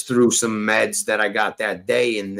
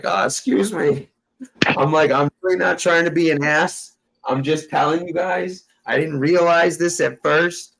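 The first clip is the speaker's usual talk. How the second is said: retelling his overly polite apology, pitched a bit higher; mock polite; 5 semitones higher than usual. Apologizing to the cops for the hidden gun, mock polite and higher. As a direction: play it meek and extra courteous to the cops.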